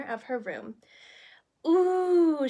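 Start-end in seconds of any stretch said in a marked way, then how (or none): 1.7-2.5 breathed in awe. A narrator's reading. Anna marvelling at the birthday dress, breathy and high. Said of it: "she breathed".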